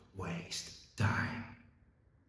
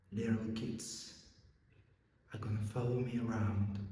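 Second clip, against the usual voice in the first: put-on soft, wistful picture of old age; quiet reverie; 12 dB quieter than usual. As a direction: drop the volume and dream aloud. Policeman imagines his old age.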